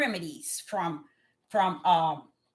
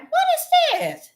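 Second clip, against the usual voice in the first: a much higher, slow amazed question; surprised; 4 dB louder, slower than usual. Retold or imitated. Preacher recalling her new hair, high amazed. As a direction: marvel at it out loud. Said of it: "And I'm like".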